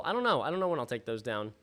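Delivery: usual speaking voice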